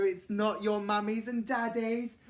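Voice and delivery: ordinary delivery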